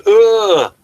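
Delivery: usual speaking voice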